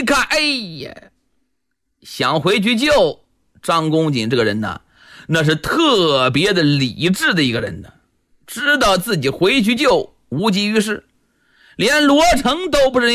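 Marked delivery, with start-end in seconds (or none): none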